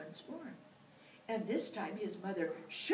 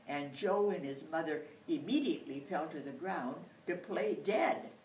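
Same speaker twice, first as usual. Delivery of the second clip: ordinary delivery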